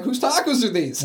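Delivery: loud, very high group yell; hammed-up high shout; retold excited call-out. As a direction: holler it across the room. Retold group calling out over leftovers.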